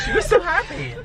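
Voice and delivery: squeaky laughing; high giggling. Real, not acted. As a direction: giggle high while speaking.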